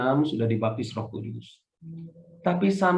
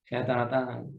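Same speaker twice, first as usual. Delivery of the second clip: ordinary talk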